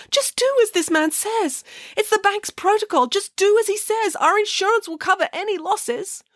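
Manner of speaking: high, anxious official voice; nervous bank manager. Acted. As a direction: plead for calm compliance, pitched high throughout. Bank manager begs compliance.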